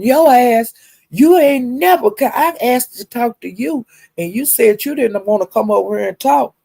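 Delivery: hammed-up yelling at her; angry retold shout. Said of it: "And she said".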